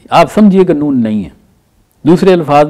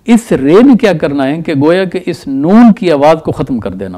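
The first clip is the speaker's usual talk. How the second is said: usual speaking voice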